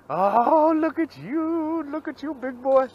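high, slow cooing at the fish; doting coo. Real, not acted. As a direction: coo at it like a pet.

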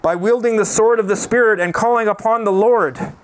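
usual speaking voice

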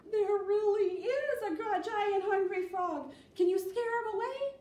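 teacher pretending a giant frog has arrived; mock alarm. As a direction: play up the panic for the kids.

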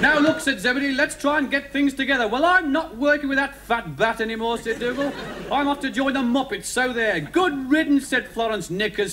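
high, insistent mock-children's-story voices; squabbling story characters. Zebedee, Dougal and Florence falling out, huffy. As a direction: argue like huffy puppets.

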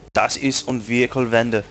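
a deliberately bad mock German accent; awful German accent. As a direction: ham up a cheesy German accent for a joke. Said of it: "That was an awful German accent".

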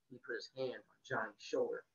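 ordinary delivery, nothing marked

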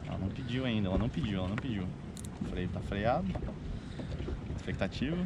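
hushed, low, tense waiting. Real, not acted. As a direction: hold your breath and murmur. Tense wait for the bite.

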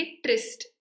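ट्रिस्ट said with a short i, "trist". Shown as a demonstration. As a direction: isolate the syllable ट्रिस्ट with an ee-like short i. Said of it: "इस आई को pronounce करते हैं". (ट्रिस्ट में छोटी इ की आवाज़)

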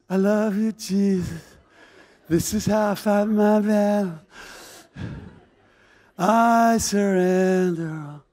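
melting into blissful surrender; dreamy devotion. Acted. Mary adoring at Jesus' feet.

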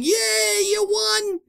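high, drawn-out mock yay; mock cheer. Gamer imitating the game's congratulations, mock cheer. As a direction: cheer as if handing out a prize.

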